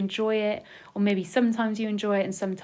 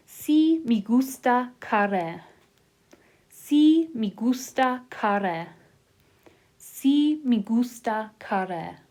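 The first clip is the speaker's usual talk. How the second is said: slow dictation; 2 semitones higher, slower than usual. Shown as a demonstration. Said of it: "we're gonna write that three times".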